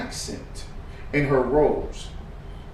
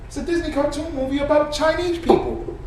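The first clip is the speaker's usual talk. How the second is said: normal talking voice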